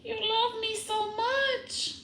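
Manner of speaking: high, slow gushing voice; doting baby talk. Retold or imitated. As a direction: coo at the puppy. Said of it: "I was like".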